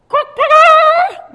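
high loud silly quack; weird bird noise. Retold or imitated. Dad hiding behind tree giving a clue, silly quack. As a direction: squawk like a daft chicken. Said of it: "weird chicken noise".

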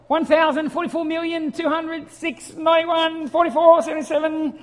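lightly voiced child counting nonsense; kids counting. Small boys counting to ten, light child voice. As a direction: count like a small boy who can't yet. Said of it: "this is how they used to count".

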